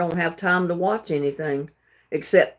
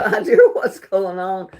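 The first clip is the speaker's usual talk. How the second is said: usual speaking voice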